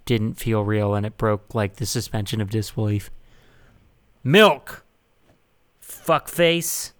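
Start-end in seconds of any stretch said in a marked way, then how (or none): 4.2-6.9 loud barked call; shouted order. Acted. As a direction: call it out across a bar. Shouted order, mock bar call.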